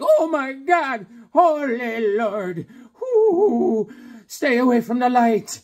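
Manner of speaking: high, drawn-out hammed-up cries; mock terror at a ghostly sight; awestruck panic. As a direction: wail it slowly, way up high. Acting out Beckerman's shock at apparition.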